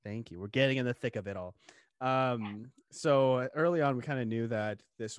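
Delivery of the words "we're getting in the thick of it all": ordinary talk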